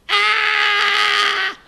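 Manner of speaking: high, drawn-out monk's scream; mock scream of the horrified monk; retold scream. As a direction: let out a long high "Ah!". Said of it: "And he begins screaming".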